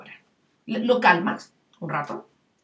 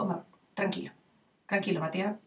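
soft, lower, calming a twin; soothing parent; 3 semitones lower, 7 dB quieter than usual. Retold or imitated. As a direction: hush an upset child gently. Parent calming his upset son.

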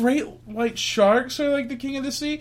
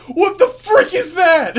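startled jogger; 10 semitones higher, 8 dB louder than usual. Retold or imitated. Imagined jogger finding squid.

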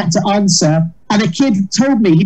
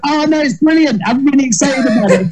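high, bright mock enthusiasm; gushing excitement; 6 semitones higher than usual. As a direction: gush about how brilliant it is. Retelling his own gushing reaction.

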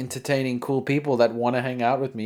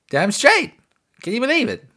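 higher, louder fake hype; mock boast; 12 semitones higher, 5 dB louder than usual. Host brags about a fake record deal, hammed-up hype. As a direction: oversell the fake news, then drop it. Mock bragging before "I'm joking.

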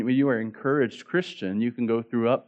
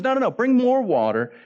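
high, louder mock command; acted insistent order; 12 semitones higher, 5 dB louder than usual. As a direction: imitate someone eagerly demanding more, pitched way up. Acting out Elijah's insulting order.

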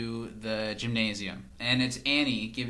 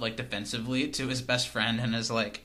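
ordinary delivery

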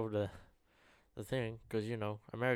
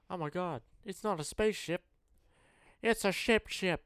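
excited movie quote; 9 semitones higher, 6 dB louder than usual. Retold or imitated. Quoting movie 2012.

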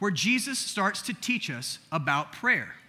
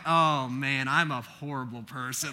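guilty groan; 7 semitones lower, slower than usual. Retold or imitated. Retells his guilty realisation.

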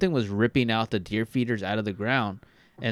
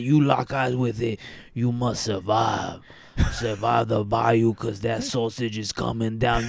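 put-on New Orleans accent. New Orleans accent, hamming up a bayou legend for his cohost. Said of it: "fantastic fucking New Orleans accent".

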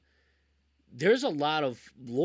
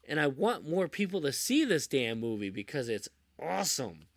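normal talking voice

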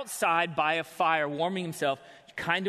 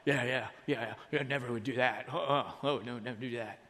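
dismissive mumble; 5 semitones lower, 4 dB quieter than usual. Acted. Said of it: "the rest of them are like".

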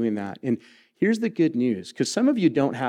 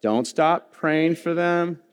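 Don't stop praying for them, slow and higher; slow leading line; 6 semitones higher, slower than usual. Shown as a demonstration. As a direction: lead the room through it slowly. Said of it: "Let's try it".

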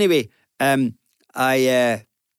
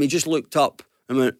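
ordinary talk

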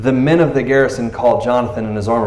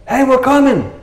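high, louder mock shout of enemy soldiers; enemy soldiers calling; 12 semitones higher, 4 dB louder than usual. Philistines shouting down at Jonathan, high mock call. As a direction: call down the hill like a cocky soldier.

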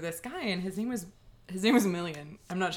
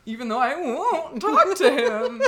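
swooning; 4 semitones higher, 7 dB louder, slower than usual. Retold or imitated. Gushing over a crush.

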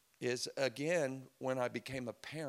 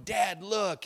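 put-on louder, higher kid voice; tough kid; 7 semitones higher, 7 dB louder than usual. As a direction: show Dad the broken arm proudly. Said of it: "and he's going".

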